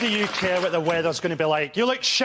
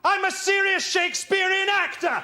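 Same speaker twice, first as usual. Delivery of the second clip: much higher, shouted outrage; a hammed-up actor's fury; indignant rant; 10 semitones higher than usual. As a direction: rant like a wounded Shakespearean ham.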